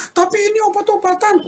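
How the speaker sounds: very high put-on wifely voice; protesting wife. Wife questions husband's only cure, high-pitched protest. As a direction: squeal the objection like a worried wife.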